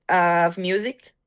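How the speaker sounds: ordinary talk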